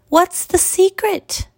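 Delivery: very high, slow pleading pupil voice; Avida. A narrator's reading. Struggling pupil begs the master's tip, high sighing plea. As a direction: ask slowly, high-pitched, like a struggling young pupil. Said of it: "sighed Avida".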